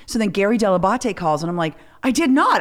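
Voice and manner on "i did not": high, louder flat denial; indignant denial. Retold or imitated. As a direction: insist you didn't, loudly. Denying on air.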